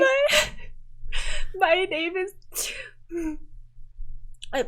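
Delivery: high, teary voice with sobs catching; crying girl. Acted. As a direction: choke out the words through tears. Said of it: "She's crying a little less".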